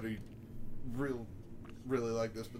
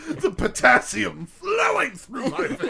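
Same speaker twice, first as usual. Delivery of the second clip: proud shout; 7 semitones higher, slower than usual. Pretend. Shouted boast bit.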